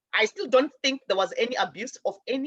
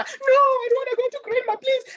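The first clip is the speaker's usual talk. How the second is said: high, fast, desperate begging; a frightened child pleading much higher and faster; 13 semitones higher, 3 dB louder than usual. Retold or imitated. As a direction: beg not to be taken to grandma. Child begging not to go home.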